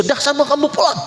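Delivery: high-pitched acted dismissal; mock scolding send-off; chasing someone away. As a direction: send them packing, pitch jumping up. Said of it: "bahkan diusir".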